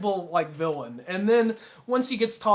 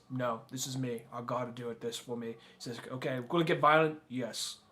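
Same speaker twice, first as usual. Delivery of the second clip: quiet sincere quote; 6 semitones lower, 8 dB quieter than usual. Retold or imitated. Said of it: "He's just like".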